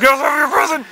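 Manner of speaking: high, loud announcement; dad yelling. Acted. Dad sends son outside to his present, yelling. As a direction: holler it up high.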